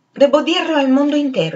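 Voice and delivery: high, loud proclamation; exclaiming. A narrator's reading. Septimus raising his hand, proclaiming to the world, high and loud. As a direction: announce it as if to the whole world. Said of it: "esclamò Septimus".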